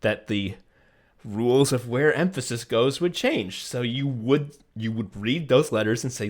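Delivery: normal talking voice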